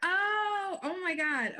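loud startled oh my God; surprised. Real, not acted. Caught off guard by request.

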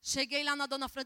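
usual speaking voice

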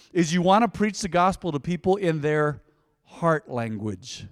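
normal talking voice

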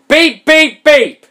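high, loud electronic beeping; imitated alarm beeps. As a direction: mimic an alarm clock going off. Imitating alarm clock from the story.

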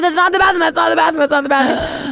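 high, panicked repeat, fading down; yelling to stop a friend peeing; frantic warning. Retold or imitated.

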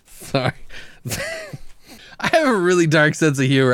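ordinary delivery

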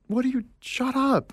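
high-pitched mock complaint; woken parents protesting. Sleepy parents to the noisy uncle at dawn, mock protest.

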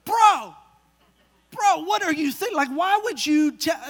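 dad's high, exasperated "bro". Retold or imitated. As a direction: cut the son off in disbelief. Dad scolding son in car.

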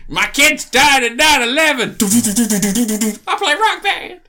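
high, loud slow Sandler voice; mock Adam Sandler. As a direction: ham up a whiny Sandler. Parody of Sandler role.

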